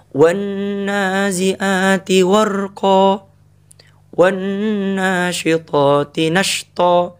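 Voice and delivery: slow, forceful chant; stern recitation. Shown as a demonstration. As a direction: recite it harshly. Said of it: "Keras itu". (tilawah keras)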